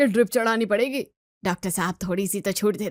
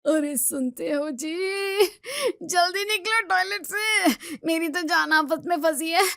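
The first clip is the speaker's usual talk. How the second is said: desperate calling; 6 semitones higher than usual. Acted. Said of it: "तुम इतनी जोर से चिल्लाओ मत".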